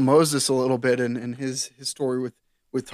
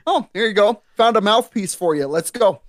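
lightly voiced God, higher and louder; breezy God; 7 semitones higher, 5 dB louder than usual. God handing Moses a mouthpiece, breezy and higher. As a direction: toss it off like God who has already fixed it.

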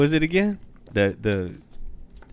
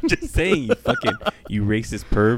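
real laughter through the words; laughing teasing. Real, not acted. Co-host calling his friend a perv, laughing. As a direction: tease while cracking up. Joking insults while cracking up.

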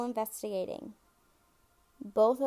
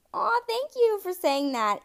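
much higher and louder, touched and gushing; delighted; 10 semitones higher, 8 dB louder than usual. Real, not acted. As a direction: squeal your thanks. Touched by listener's compliment.

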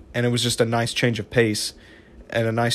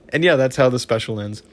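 ordinary talk

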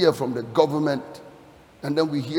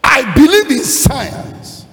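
loud, high, drawn-out declaration; emphatic proclamation; 9 semitones higher, 9 dB louder, much slower than usual. Real, not acted. Preacher telling the congregation his belief, emphatic proclamation. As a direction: proclaim it slowly and forcefully.

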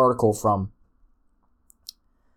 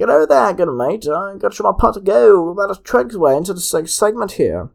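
high, loud leprechaun brogue, hurrying along; hammed-up Irish leprechaun voice; 8 semitones higher, 7 dB louder than usual. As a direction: chatter it out in an Irish leprechaun accent. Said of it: "whatever a leprechaun is".

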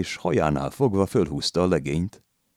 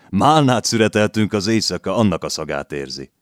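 loud, higher mock excuse; teasing old man; 4 semitones higher, 6 dB louder than usual. A narrator's reading. Old man teases the landlady, loud and higher. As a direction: tease her back, raising the voice.

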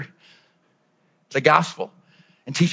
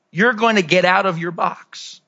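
usual speaking voice

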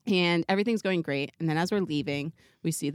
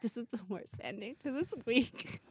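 much softer, despairing mutter; defeated mutter; 3 semitones higher, 11 dB quieter than usual. Retold or imitated. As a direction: say it under your breath, beaten. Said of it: "it's like".

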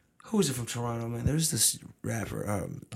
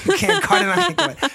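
laughing mock [name]; 9 dB louder than usual. Mocking [name], laughing, loud.

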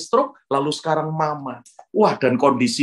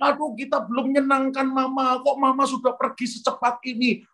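high-pitched mock lament; imitated wailing cry; 9 semitones higher than usual. In-laws lamenting that mama left so soon, mock wail. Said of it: "ipar-ipar saya teriak".

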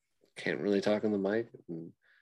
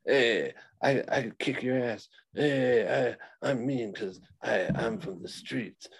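mock tough guy; 3 semitones higher, slower than usual. Mocking a wrestler bragging to old ladies, a hint of a tough-guy voice. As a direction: puff up like a fake hard man at a buffet. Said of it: "being like".

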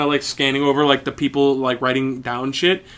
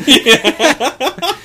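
high squealing laughter; cracking up hard; laughing; 4 dB louder than usual. Real, not acted. Hysterical laughing at the joke.

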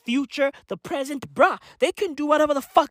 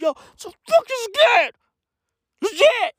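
high mock fear; 10 semitones higher than usual. High scared wail.

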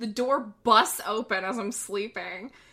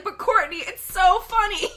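whiny pleading; 10 semitones higher, 7 dB louder than usual. Retold or imitated. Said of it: "I'm gonna be like".

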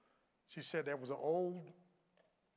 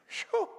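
sharp exhale; 9 dB louder than usual. Real, not acted. Preacher exclamation.

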